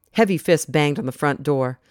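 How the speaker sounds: ordinary delivery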